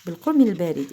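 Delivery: ordinary talk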